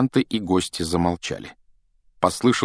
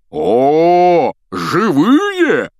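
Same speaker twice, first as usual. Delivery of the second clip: much higher, loud exclamation; hammed-up loud surprise; mock surprise; 9 semitones higher, 7 dB louder, much slower than usual. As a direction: fake astonishment at the living. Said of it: "воскликнул сэр Патрик".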